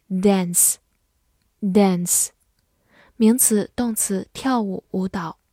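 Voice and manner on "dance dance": "dance" with a front "a" as in "cat"; American-style "dance", short front vowel; American pronunciation. Shown as a demonstration. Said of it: "美式发音读作".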